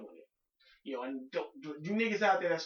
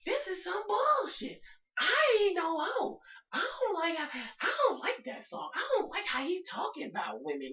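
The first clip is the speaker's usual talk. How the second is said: mock outraged woman; 13 semitones higher, 3 dB louder than usual. Women upset over a song, mock outraged high whine. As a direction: squeal it like an indignant woman crying foul. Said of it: "women went nuts".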